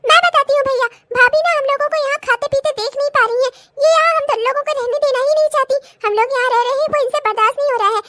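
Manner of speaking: mother siding with her son, weeping; tearful mother. Acted. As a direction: complain with a crying edge. S1 plays the mother.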